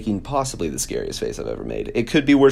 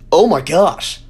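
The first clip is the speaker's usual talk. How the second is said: higher, louder shocked gasp of words; 7 semitones higher, 8 dB louder, slower than usual. Real, not acted. Vlogger scared by own selfie, shocked outburst. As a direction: blurt it out in shock. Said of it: "I got scared out of my mind".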